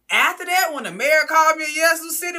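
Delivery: loud, high, excited telling; excited shout. Real, not acted. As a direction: shout it with glee.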